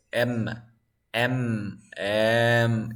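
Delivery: "M" hummed with vocal-cord vibration; voiced M sound. Shown as a demonstration. Teacher having students feel the throat vibration, voiced humming M. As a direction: draw out the buzz on "M". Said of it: "You feel a vibration".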